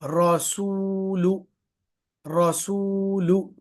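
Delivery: रसूलु slowly, सू and लु with rounded lips, long ऊ held; rounded lips, stretched. Shown as a demonstration. Teacher demonstrating rounded lips on the pesh. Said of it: "सीन और लाम दोनों गोल होंठ के साथ".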